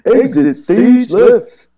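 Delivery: high, slow theatrical cartoon voice; hammy cartoon stage exit; Snagglepuss.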